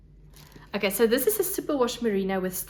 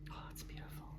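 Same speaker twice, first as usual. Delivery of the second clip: whispering; 4 semitones lower, 22 dB quieter, much faster than usual. Real, not acted. Hushed awe over fiber.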